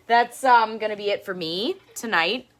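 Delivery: ordinary delivery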